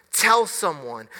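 usual speaking voice

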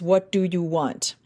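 ordinary talk

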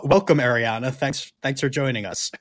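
ordinary talk, nothing marked

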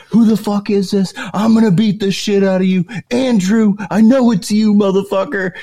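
loud, high-pitched outraged yelling; imitated freak-out. Pranked buddy threatening the caller, high imitated freak-out. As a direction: yell it high, like a buddy losing it on the phone. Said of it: "He's freaking out".

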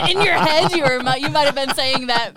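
laughing loudly while teasing. Real, not acted. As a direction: tease a friend through loud laughter. Laughing retelling friend's thoughts.

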